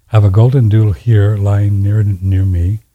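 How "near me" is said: ordinary talk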